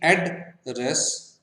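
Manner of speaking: एड्रेस broken into two beats, एड, रेस; two-syllable split. Shown as a demonstration. Teacher demonstrating two syllables, एड्रेस split as एड, रेस. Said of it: "टू syllables जैसे कि".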